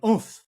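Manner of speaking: loud, high imitated grunt of shock; guttural oof. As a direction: let out a punched "oof", imitating his gut reaction. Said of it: "it made a guttural sound".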